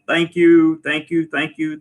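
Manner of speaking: ordinary talk, nothing marked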